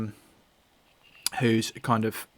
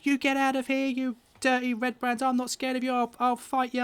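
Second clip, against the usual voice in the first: high-pitched little lad squaring up; small boy's plucky threat; Carp, nervous boy; 12 semitones higher, 4 dB louder than usual. Acted. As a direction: challenge the strangers in a child's high voice. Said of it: "he's kind of nervous".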